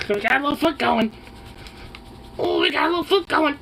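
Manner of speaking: excited squeak at the paw. Real, not acted. High fast baby talk.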